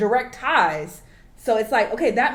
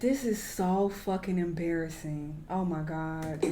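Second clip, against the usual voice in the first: softer, slowed, disgusted groan; embarrassed; 5 semitones lower, 10 dB quieter than usual. Real, not acted. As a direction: mutter it, cringing. Reacts to embarrassing clip.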